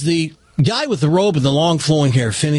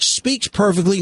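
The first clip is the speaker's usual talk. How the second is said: normal talking voice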